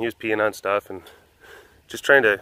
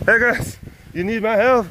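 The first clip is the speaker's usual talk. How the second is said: much higher, chirpy offer of help; eager helper; 10 semitones higher than usual. Retold or imitated. Offering help to the workers, chirpy and eager.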